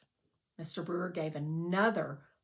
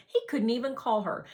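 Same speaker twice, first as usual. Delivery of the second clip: plain reading voice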